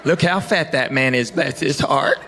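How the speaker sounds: lightly voiced gossip with a sweet tag; hint of Alabama church lady; catty Southern lady. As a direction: insult then soften it sweetly. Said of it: "women in Alabama".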